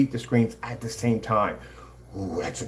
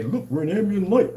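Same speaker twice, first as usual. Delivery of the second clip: high mock boast of rival demonstrators; put-on high voice, scornful; mocking imitation; 9 semitones higher than usual. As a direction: mimic them in a raised, silly pitch. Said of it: "trying to say".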